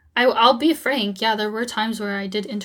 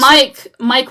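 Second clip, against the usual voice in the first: calling out; 11 dB louder than usual. Real, not acted. Calling over interruption.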